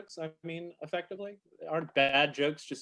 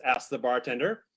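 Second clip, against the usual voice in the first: ordinary delivery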